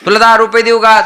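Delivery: high-pitched voiced mother's offer; coaxing mother. Mother bribing her crying child, high coaxing voice. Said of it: "आई स्वतःहून म्हणते".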